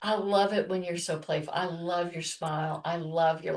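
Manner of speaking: husband quietly telling his wife he loves her playfulness; tender affection. Retold or imitated. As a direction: speak it fondly and quietly. Wife voices husband's loving words.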